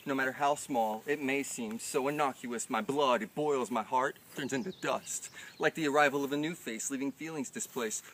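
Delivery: building, rising, loud slam delivery; spoken word poem. Acted. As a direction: recite the poem with mounting intensity.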